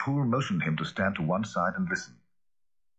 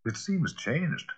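shaken; 8 semitones higher, much slower than usual. A narrator's reading. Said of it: "very pale".

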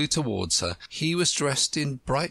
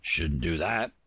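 put-on old frog; 7 semitones lower than usual. Frog warns.

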